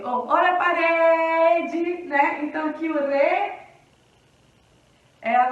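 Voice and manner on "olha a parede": loud, slow cry with "re" in "parede" held; market vendor's cry. Shown as a demonstration. Teacher announcing parede like fruit at the fair, slow vendor's cry. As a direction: hawk it, dragging out the "re" of "parede".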